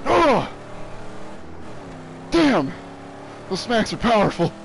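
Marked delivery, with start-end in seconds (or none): 0.1-2.7 surprised exclamation. Real, not acted. Reacting to smacks.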